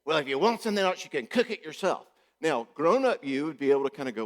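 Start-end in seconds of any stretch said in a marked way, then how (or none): 0.0-2.1 a higher, louder snappy retort; mock snap back. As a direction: fire it back at him like a hurt kid. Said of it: "she snaps back and goes".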